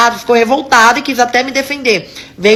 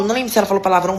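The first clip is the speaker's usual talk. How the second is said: normal talking voice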